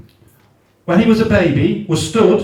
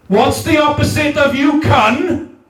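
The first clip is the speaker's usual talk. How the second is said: laughing shout, pitched higher, calling out to the whole crowd; 6 semitones higher than usual. Acted. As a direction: throw it out to the room like a chant leader. Leading the crowd in the call.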